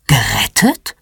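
low, mildly startled question on one word; a low, rising double-take. A narrator's reading. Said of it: "Mama ist plötzlich hellwach".

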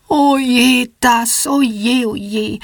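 high, slow little angels' groan fading at the end. A narrator's reading. Little angels groan at the hard lesson, high and slow. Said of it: "die Engelchen stöhnen".

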